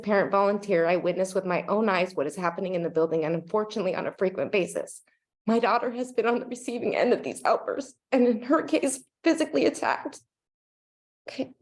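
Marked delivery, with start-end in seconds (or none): 5.5-11.5 real choked-up strain, pitch jumping at the end; tearful, voice catching and rising; fighting tears. Real, not acted. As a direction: hold back crying, pausing to regain composure. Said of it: "get through this without crying".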